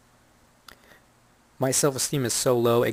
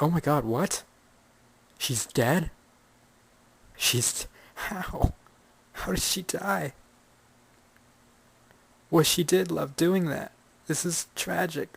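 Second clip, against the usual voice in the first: higher, hammed-up gasping disbelief; mock shock; 7 semitones higher, slower than usual. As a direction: overplay the horror, half asleep. Mock shock at bad news.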